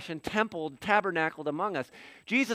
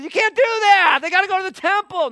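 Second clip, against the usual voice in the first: much higher and louder, protesting hard; outraged objection; 13 semitones higher, 10 dB louder than usual. Acted. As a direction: cry out like indignant religious leaders. Religious leaders object to Jesus.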